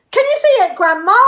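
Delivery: high, loud excited call; high loud boy calling; George shouting. A narrator's reading. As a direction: shout up to the roof. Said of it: "George shouted".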